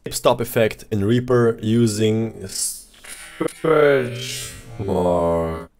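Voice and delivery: very slow, stretched-out warped words; like a cassette winding down; tape stopping. Shown as a demonstration. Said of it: "if I enable this option".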